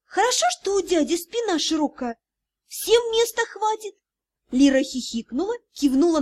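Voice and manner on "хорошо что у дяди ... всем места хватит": put-on high, light girl's aside; playful girl voice. Niece jokes to heroine while hiding, high. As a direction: tease in a high voice.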